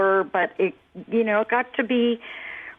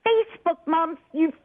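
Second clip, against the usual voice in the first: retold screaming; 8 semitones higher than usual. Mother retells her son screaming about Facebook, high mock outrage. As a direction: imitate an outraged son, pitch jumping up. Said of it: "starts screaming at me".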